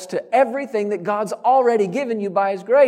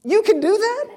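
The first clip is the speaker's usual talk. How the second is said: high, rising squeak of disbelief; incredulous question; 13 semitones higher than usual. Acted. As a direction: ask it as if astonished it's allowed. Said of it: "I read that and think".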